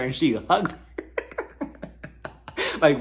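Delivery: ordinary talk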